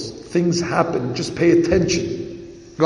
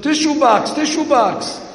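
higher and louder, pressing the words tissue box; insistent repeated request; 8 semitones higher, 3 dB louder than usual. Retold or imitated. Man pressing his neighbour for the tissue box, insistent and louder. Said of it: "He tells him".

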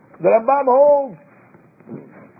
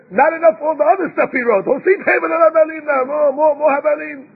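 high, emphatic retelling of a takedown; scathing rebuttal; 7 semitones higher, 3 dB louder than usual. Retold or imitated. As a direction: pile on the scorn, pitched high and insistent. Acting out Ramban's scathing rebuttal.

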